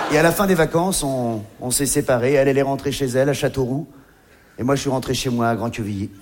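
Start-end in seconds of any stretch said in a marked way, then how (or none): none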